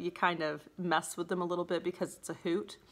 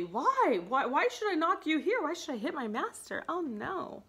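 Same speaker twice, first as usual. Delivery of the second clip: high, swooping puzzled protest; mock disbelief; 7 semitones higher than usual. Grumio refusing to hit his master, mock-baffled. Servant retold balking at hitting master.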